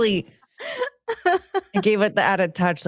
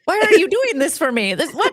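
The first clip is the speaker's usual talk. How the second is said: flustered gushing; 8 semitones higher, 3 dB louder than usual. Retold or imitated.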